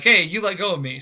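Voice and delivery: mock-frustrated yell, let go of me; frustrated rabbit. Rabbit yelling at the stuck tar baby, frustrated. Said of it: "he gets really frustrated".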